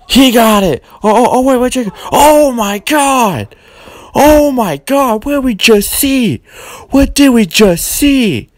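stunned commentator exclaiming; excited call. Acted. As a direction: scream the near-fall like a ringside announcer.

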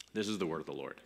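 low, soft formal close; quietly seal the reading; liturgical closing. Acted. Pastor ends the reading for the congregation, low and formal.

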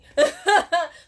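loud, high laugh bursting out; big real laugh at her own joke; burst of laughter. Real, not acted.

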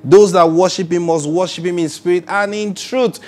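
high, loud preacher's cry; full-throated sermon peak; preaching at full force. Acted. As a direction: lift the congregation with a loud climb.

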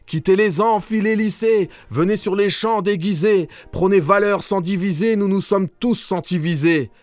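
high, declaimed call to the crowd; rallying call. Acted. Poet urging students out of the lecture halls, high rallying cry. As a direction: call them out to march, pitched high.

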